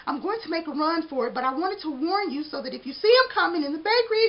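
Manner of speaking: high, anxious warning; pleading little donut; urgent donut voice. Acted. Arnie warning baker.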